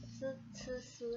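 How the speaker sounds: soft "Su" with the tongue behind the teeth; tongue behind the teeth, softly: "su"; quiet hissed "Su, su, su"; flat tongue su. Shown as a demonstration. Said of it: "lidahnya di belakang gigi".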